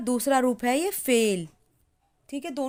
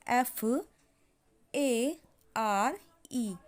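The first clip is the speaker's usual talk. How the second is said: slow dictation spelling; much slower than usual. Shown as a demonstration. Spelling out letters.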